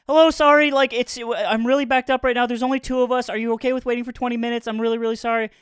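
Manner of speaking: a hint of a flustered Mexican-accented cashier, high; hurried Mexican accent. Friends retell the Taco Bell cashier in a mock Mexican accent. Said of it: "thick Mexican accent is just like".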